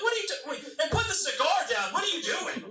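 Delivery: high-pitched, flustered scolding questions; exasperated dad. Acted. Dad to son playing subcontractor, exasperated. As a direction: break off and scold in high disbelief.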